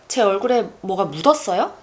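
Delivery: 묻었어요 punched and rising; stress on 묻었어요. Shown as a demonstration. Asking if anything is on my face, stress on 묻었어요. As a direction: pass over 뭐가, lean on 묻었어요. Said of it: "if I place the emphasis on 묻었어요".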